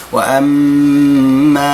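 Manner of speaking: وأما with the vowel stretched six counts; long madd. Shown as a demonstration. Teacher demonstrating the long madd, very slow. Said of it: "ستة ديال الحركات".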